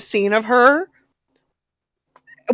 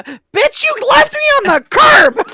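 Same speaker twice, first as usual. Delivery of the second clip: furious yell, rising to a scream; angry ex shout; 8 semitones higher, 3 dB louder than usual. Acted. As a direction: scream it like a scorned ex. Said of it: "acting out the scene".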